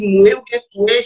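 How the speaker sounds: "mouer" and "touer" with Quebec "oué" vowel; prononciation québécoise. Retold or imitated. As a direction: imitate Quebec "moué, toué" for moi, toi. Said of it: "quelqu'un va me dire". (dire « mouer » et « touer » avec le « oué » québécois)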